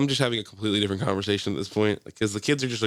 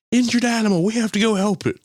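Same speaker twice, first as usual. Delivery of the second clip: put-on high, louder children's plea; imitated excited kids; 12 semitones higher, 5 dB louder than usual. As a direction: mimic kids raising the alarm. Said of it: "and they're like".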